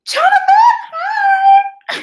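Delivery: sudden high, loud shout of hi; excited greeting. Real, not acted. Greeting a viewer who joined the live stream, high excited shout. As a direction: call out delighted to a viewer joining.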